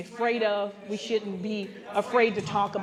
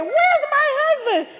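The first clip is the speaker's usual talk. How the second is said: high, loud, slow laughing demand; wife calling for her missing husband, high and laughing; laughing outrage; 7 dB louder than usual. Retold or imitated. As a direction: call out half laughing, half indignant.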